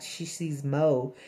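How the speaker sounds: ordinary talk, nothing marked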